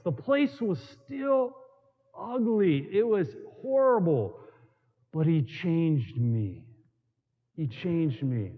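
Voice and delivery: ordinary talk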